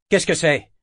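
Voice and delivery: irritated sharp demand. A narrator's reading. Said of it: "irrité".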